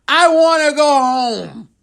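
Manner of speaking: high, pleading whine, slowing at the end; mock whine. Comic retelling his answer to the jail guard, mock pleading whine. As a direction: beg like a homesick kid. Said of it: "I told him".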